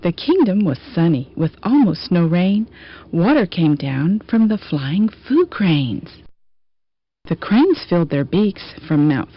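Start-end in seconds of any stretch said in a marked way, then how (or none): none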